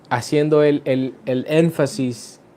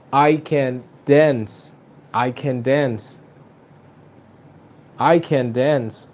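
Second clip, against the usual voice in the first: slow, "dance" stressed and falling; much slower than usual. Shown as a demonstration. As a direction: land the weight on "dance". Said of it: "the emphasis is going to be in the adjective". (énfasis en "dance", lento)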